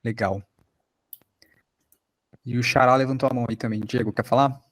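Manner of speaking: normal talking voice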